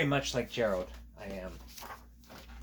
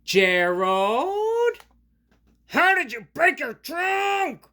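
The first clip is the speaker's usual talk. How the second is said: much higher, louder, slow yell; frustrated scream; 9 dB louder, much slower than usual. Acted. Piggy loses patience with Gerald, screaming. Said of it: "Piggy ... frustrated and scream".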